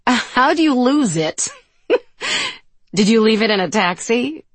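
high, laughing questions; convulsing with laughter. A narrator's reading. Sabrina teases Freya about losing her voice, convulsing with laughter. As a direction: tease through the giggles, voice climbing. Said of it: "Sabrina is convulsing with it too".

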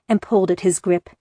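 usual speaking voice

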